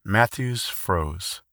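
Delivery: usual speaking voice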